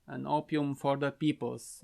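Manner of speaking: ordinary talk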